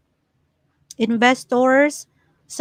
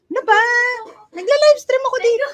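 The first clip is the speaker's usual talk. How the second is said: exasperated; 11 semitones higher, much faster than usual. Real, not acted. Scolding daughter interrupting livestream.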